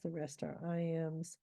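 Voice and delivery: usual speaking voice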